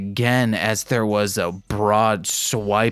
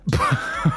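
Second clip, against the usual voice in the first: short breath of laughter; 5 semitones higher than usual. Real, not acted. Reader embarrassed by the accent, short laugh. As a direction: laugh at your own terrible accent.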